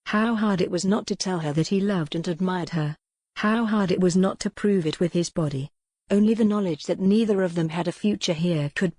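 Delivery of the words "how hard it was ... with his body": ordinary delivery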